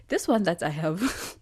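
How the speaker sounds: slipping into a Ghanaian accent. Real, not acted. Musing on her coursework in a Ghanaian accent. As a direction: let the Ghanaian accent come through. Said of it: "now this is my Ghanaian accent coming out".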